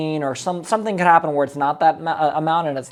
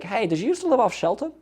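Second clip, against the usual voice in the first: higher, light neighborly question; casual and familiar; 6 semitones higher, 3 dB quieter than usual. Shown as a demonstration. Coach showing the right way to open, casual and light. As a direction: ask it like a friendly neighbor. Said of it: "You should be like".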